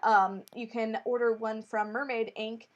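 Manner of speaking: ordinary talk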